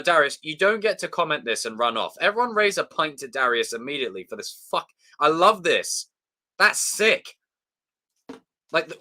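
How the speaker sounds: normal talking voice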